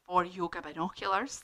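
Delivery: plain reading voice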